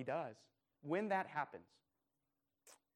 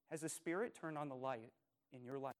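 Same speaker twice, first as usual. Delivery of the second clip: ordinary talk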